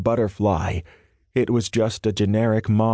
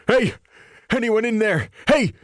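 shouting; 13 semitones higher than usual. A narrator's reading. Trapped man calling through locked door, high shouting. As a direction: pound the door and yell for anyone. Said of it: "he shouted".

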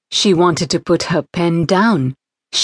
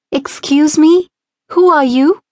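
put-on girl's voice; 9 semitones higher, 3 dB louder than usual. A narrator's reading. Girl questions stranger.

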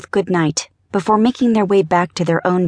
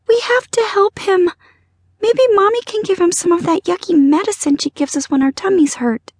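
put-on high, pleading little-girl voice; little girl crying; 12 semitones higher than usual. A narrator's reading. Tearful girl begging to help her fish, high childish cry. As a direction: plead as an upset small child. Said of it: "the little girl cried".

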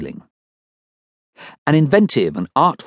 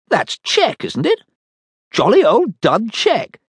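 captain's voice; 13 semitones higher, slower than usual. Acted. Captain recognises Dud.

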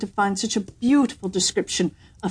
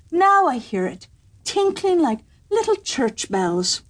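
higher, mildly gushing courtier; eager young courtier; 6 semitones higher, slower than usual. A narrator's reading. Young courtier mistaking the cow for the bird, mock delight. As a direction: gush over a cow's lowing.

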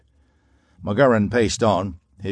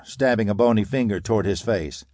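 ordinary delivery

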